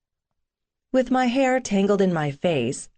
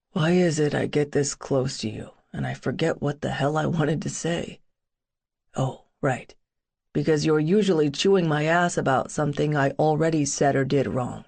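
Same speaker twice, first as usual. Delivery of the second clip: put-on brooding young man; 4 semitones lower than usual. Boone voiced by narrator.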